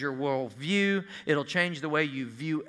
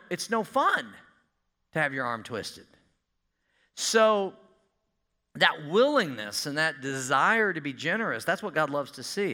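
usual speaking voice

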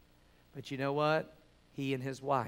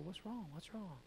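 hushed worried asking; 5 semitones higher, 15 dB quieter than usual. Retold or imitated. Brother asks crying wife again.

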